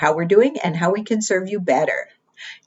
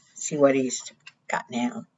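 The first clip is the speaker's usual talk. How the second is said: hushed aside; 4 semitones lower, 8 dB quieter than usual. Real, not acted. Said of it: "reader aside to children".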